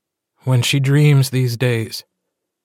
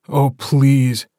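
a mildly voiced sharp snap; snapping; 3 semitones higher, 4 dB louder than usual. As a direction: brush it off with a quick bite. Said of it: "Carter snaps".

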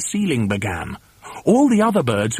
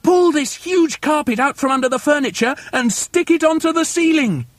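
high, urgent bossy command; high-pitched emphatic instructions; shouted orders; 11 semitones higher than usual. A narrator's reading. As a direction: drive the workers on excitedly. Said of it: "shouted Mugglewump".

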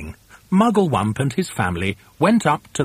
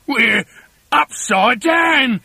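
loud, higher, drawn-out exclamation; aghast husband's cry; horrified gasp; 10 semitones higher, 5 dB louder, slower than usual. A narrator's reading. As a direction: gasp it slowly as it dawns. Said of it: "gasped Mister Twit".

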